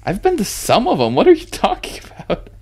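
high, indignant disbelief; incredulous. Real, not acted. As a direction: scoff at the idea. Incredulous retort.

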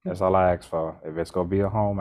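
ordinary talk

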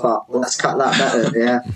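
ordinary talk, nothing marked

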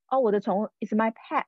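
normal talking voice

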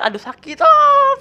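hammed-up cry rising to a high shriek; mock pain scream. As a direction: fake being hurt, ending loud and high. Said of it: "teriak-teriak".

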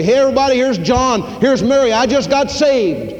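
broadcast it like over a loudspeaker; mock public announcement. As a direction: pretend announcing new converts over a microphone. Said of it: "get a microphone and go out all over Bowling Green and say".

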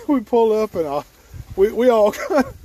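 talking through real laughter; can barely get the words out for laughing; laughing hard. Real, not acted. Telling hunting buddies the story, laughing hard. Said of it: "I'm laughing so hard".

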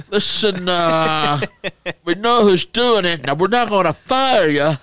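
loud, high mock Italian mobster; Italian mobster accent. Manager warning cheating workers, Italian mobster accent. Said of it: "he had the Italian mobster accent".

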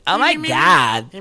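slow, whiny nasal drawl; mock a snooty girlfriend, nasal and slow.

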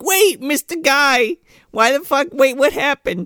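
mock confused hero. Mocking the hero finding a dying man, high and dopey. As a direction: play him baffled and whiny. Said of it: "He's like".

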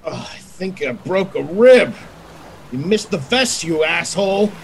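high, strained with pain; groaning through a hurt rib. Acted. Just shot, says he broke a rib.

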